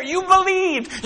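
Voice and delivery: very slow, drawn-out "You believed."; slow tender "You believed." as proud affirmation; slow praise. Acted. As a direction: stretch "You believed." out, savouring it. God praising the faithful.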